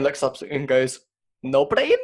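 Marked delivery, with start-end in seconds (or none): 1.4-2.0 put-on Russian accent. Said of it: "in a Russian accent".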